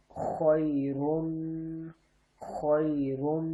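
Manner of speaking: خیرن drawn out slowly, final رن nasal and held; held nasal ghunna. Shown as a demonstration. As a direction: hum the ن of خیرن through the nose, slowly. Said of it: "دو پیش ہیں تو غنہ کر رہا ہوں".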